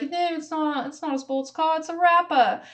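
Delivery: hammed-up British accent from a pub scene; mock British accent. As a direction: quote the ad in a put-on British accent.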